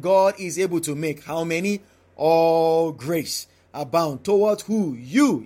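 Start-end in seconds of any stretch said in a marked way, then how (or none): 2.2-3.5 "All" drawn out long and hard; stress on ALL. Shown as a demonstration. Preacher pointing listeners to the emphasis, stress on ALL. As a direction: lean heavily on "All", stretching it out slowly. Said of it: "note here the emphasis".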